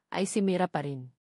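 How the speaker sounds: ordinary delivery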